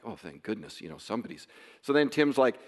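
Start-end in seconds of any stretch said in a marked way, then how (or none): 0.0-1.5 much softer and lower, choked with relief; emotion welling up. Retold or imitated. Father seeing strangers repost, softly overcome with emotion. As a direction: let the relief catch in the throat, quietly. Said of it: "emotion wells up".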